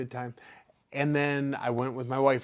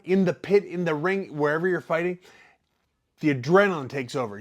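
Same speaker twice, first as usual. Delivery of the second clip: ordinary talk, nothing marked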